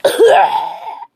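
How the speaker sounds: acted choking cough from a blow; pained cough. Jiraiya hit by the Rasengan, pained cough. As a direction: cough it out as if just struck. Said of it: "nearly killing him".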